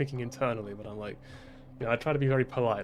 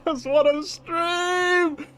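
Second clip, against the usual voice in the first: high, slow, loud whiny sob; mock crying wail; 7 dB louder, much slower than usual. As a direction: whine like a thwarted child. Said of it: "But internally, I'm just like".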